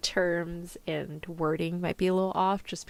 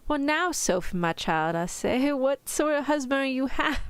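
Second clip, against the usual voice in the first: amateur British storybook father; badly faked British reading; attempted British accent; 8 semitones higher than usual. Shown as a demonstration. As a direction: put on a higher mock-British voice. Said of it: "I can't do the accent".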